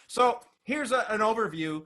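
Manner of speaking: ordinary talk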